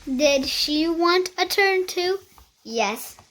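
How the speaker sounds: ordinary delivery, nothing marked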